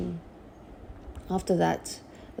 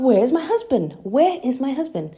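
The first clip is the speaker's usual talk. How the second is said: panicked plea; 6 semitones higher, 5 dB louder than usual. Retold or imitated. Retold panic on operating table.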